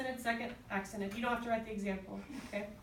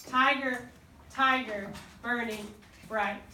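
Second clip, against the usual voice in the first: first syllable punched in "tiger" and "burning"; trochaic stress; slower than usual. Shown as a demonstration. Teacher giving an example to the class, measured trochaic stresses. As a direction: separate beats, stress on TI in "tiger", BURN in "burning", and "bright". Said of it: "it's switched".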